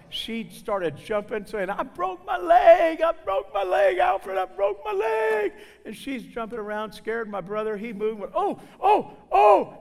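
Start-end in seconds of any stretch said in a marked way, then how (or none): 1.7-5.5 high, loud hammed-up wail of pain; panicked screaming. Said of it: "He's screaming". 8.3-9.7 high, loud yelps of pain; imitated screaming. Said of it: "He's screaming".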